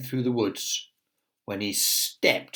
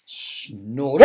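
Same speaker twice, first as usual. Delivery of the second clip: usual speaking voice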